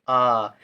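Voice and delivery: आ held as a long aa; long vowel. Shown as a demonstration. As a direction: draw आ right out. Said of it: "आ देर तक उच्चारण हो रहा है".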